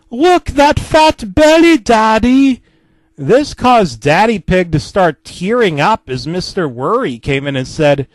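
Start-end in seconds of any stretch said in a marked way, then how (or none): 0.1-2.6 high, loud, slow taunting yell. Acted. Peppa yelling at Daddy about his belly. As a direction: holler it up at Daddy, drawn out. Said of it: "Peppa yelling".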